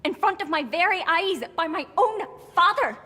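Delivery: loud, high-pitched dramatic reveal; anguished declaration. Acted. Revealing father shot the horse, anguished and loud.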